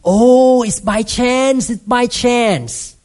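drawn-out scoffing sceptic; mock skeptic. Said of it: "people may still argue".

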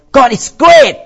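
high, loud preaching repeat; mock Southern preacher proclaiming. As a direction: belt it out twice from the pulpit. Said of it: "Like, you know".

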